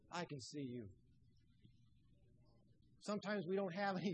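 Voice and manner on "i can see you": low and much softer, tender and close; hushed reassurance. Acted. God speaking softly to a frightened child, hushed. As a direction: drop it to a near hush, like a secret comfort. God's closing tender whisper.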